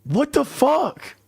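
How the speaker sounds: slow drawn-out high shocked outburst; stretched-out high disbelief; shocked what the fuck. Retold or imitated. Retelling Coxie's reaction to the photo, high shocked drawl. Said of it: "Coxie's sitting there like".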